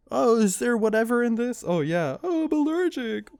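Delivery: high, silly comedy-movie voice; hammed-up, squeaky-high mimicry; zany mock voice. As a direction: send up a goofy slapstick scene. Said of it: "it's, like, really zany".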